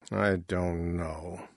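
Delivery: very soft, slow and low; at a loss. A narrator's reading. Father unsure why she left, quiet and slow. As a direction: let it sink away slowly.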